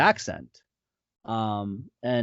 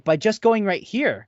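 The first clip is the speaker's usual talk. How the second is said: pitched up well above usual speech; character's Japanese-register voice; 9 semitones higher than usual. Shown as a demonstration. Actor showing where his Japanese voice sits, pitched up. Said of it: "his Japanese voice naturally".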